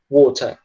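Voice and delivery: "water" with a hard T and silent final R; British "water", ending on an open vowel. Shown as a demonstration. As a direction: drop the R at the end of "water". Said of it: "tirando o som do R no final".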